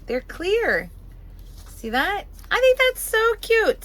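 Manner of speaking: excited. Real, not acted. Excited show-and-tell of clear Post-its.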